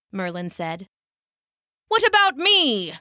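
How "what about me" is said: high-pitched indignant call; called-out protest. A narrator's reading. Knight lashed to a tree, calling out in protest. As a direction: call out to be noticed, pitched well up. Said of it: "called Sir Marcus".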